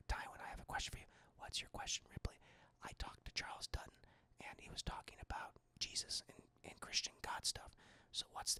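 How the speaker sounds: hushed whispered conversation; whispering. Retold or imitated. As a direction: whisper it secretly. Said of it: "very whisper-heavy conversation".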